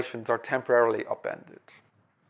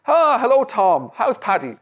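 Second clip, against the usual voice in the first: bright, higher, louder hello at the door; cheery greeting; 7 semitones higher, 6 dB louder than usual. Acted. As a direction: greet him brightly, not yet knowing.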